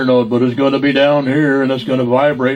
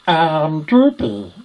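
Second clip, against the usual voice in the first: cartoon character impression; sleepy-eyed cartoon hound greeting folks; Droopy; 4 semitones higher, much slower than usual. Shown as a demonstration. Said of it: "what's one of the great ones?".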